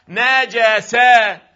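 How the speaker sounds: "نجسة" as na-ja-sa, each syllable stretched and loud; fatha on jim. Shown as a demonstration. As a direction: chant out "نجسة" slowly with a after the jim. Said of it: "أما الجيم تكون ماذا؟". («نجسة» بفتح النون والجيم، بمدّ وصوت عالٍ)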